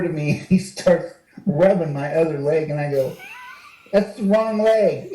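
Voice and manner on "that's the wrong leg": said through a laugh, slowed; amused retold line; laughing quip. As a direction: laugh while correcting him.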